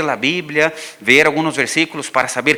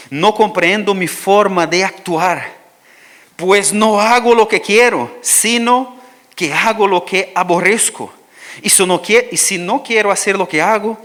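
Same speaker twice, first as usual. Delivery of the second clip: lectura bíblica enfática; 4 semitones higher, 5 dB louder than usual. Acted. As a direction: read scripture slow and louder, with weight. Reads Romans aloud. (lectura solemne y enfática de las Escrituras)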